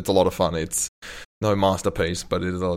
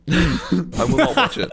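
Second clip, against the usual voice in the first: laughing refusal; 11 semitones higher, 3 dB louder than usual. Real, not acted.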